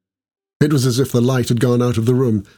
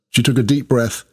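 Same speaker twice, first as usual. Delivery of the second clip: ordinary delivery, nothing marked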